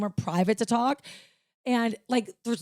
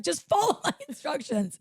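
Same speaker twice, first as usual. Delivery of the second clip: high-pitched exasperated command, laughing through it; retold aggravation; 11 semitones higher than usual. Customer ordering the bank clerk about, aggravated. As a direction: act out losing patience, voice pitched way up. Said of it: "I was so aggravated".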